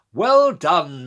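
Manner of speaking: loud, high booming praise. A narrator's reading. Chief inspector congratulating Georgia, loud booming praise. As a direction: boom it across the room. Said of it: "she boomed in a loud voice".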